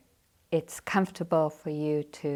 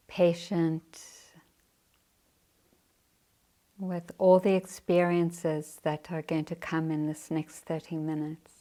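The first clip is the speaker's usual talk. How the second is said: guided meditation. Acted. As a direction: guide the sitters gently into practice.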